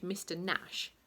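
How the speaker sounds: usual speaking voice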